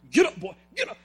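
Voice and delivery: mock drill sergeant order; a touch of a drill sergeant. Preacher showing what Paul is not, as a mock drill sergeant. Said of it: "this isn't a drill sergeant".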